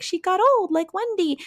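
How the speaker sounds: put-on high-pitched kid exclamation; imitated children. Kids talking about Lara grown old, imitated child voice. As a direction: say it like a marveling child.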